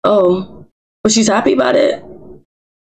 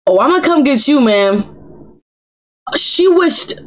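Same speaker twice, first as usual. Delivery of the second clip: ordinary delivery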